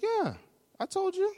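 very high-pitched, put-upon answer; exasperated reply. Acted. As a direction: answer as if it were obvious. Woman answers pastor testily.

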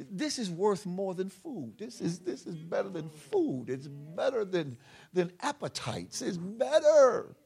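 high, drawn-out, building to a shout; impassioned preaching. Real, not acted. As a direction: build fervently to a loud peak.

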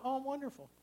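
sugary mock church politeness; put-on cheeriness. Faking cheer at church greeter.

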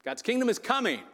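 bright, high "God's kingdom is coming" as good news. Shown as a demonstration. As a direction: announce "kingdom" like joyful news. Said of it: "That's good news, right?".

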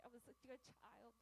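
ordinary talk

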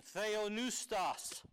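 theopneustos said very slowly, syllable by syllable; slow, deliberate theopneustos; Greek word spelled out. Shown as a demonstration. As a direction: lay out theopneustos carefully, like a teacher. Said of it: "Paul uses a special word".

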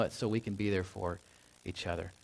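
ordinary talk, nothing marked

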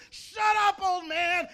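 high, full-force angry scream. Acted. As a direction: scream it right at the old man. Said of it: "and screams at him".

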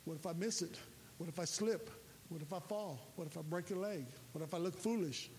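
a hint of a nervous cadet; fearful worrying. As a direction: voice the cadet's quiet fears. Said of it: "and you'll be going".

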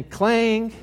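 high, sustained clang; imitated clang. Preacher retelling another fall of the knife, high clang. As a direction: mimic the plate ringing, pitched high. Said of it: "this very loud clang sound".

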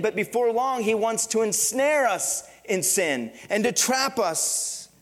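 loud, rising preacher's urging; impassioned pulpit delivery; preaching at full force. Acted. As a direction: drive the warning home to the church.

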